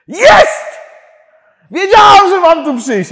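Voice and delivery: very high, full-force shriek; ecstatic screaming. Retold or imitated. Woman overjoyed she came for prayer, imitated as an ecstatic scream. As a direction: imitate her sudden, screamed outburst of joy. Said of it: "Ona zaczyna krzyczeć".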